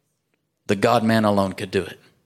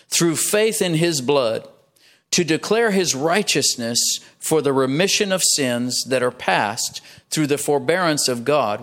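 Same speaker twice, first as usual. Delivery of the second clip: slow, deliberate scripture reading; measured verse reading. Real, not acted. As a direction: read it phrase by phrase, taking time. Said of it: "I'm gonna read it slowly".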